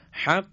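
حق ending in a sharp full stop on the qaf; stopped qaf. Shown as a demonstration. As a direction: cut the air off hard on the final q of حق. (قاف با قطع کامل صوت در حق)

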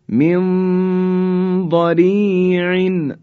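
من ضریع very slow with nasal nun; Quran recitation. Shown as a demonstration. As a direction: draw out من ضریع. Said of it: "نون ساکن به حرف ضاد رسید".

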